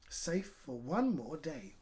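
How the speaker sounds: plain reading voice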